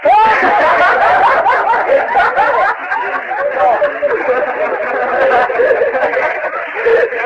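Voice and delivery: long, high helpless laughter; real laugh. Real, not acted. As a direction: laugh hard and long at the memory.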